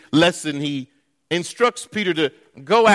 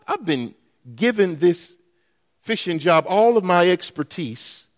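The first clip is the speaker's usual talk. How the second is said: ordinary delivery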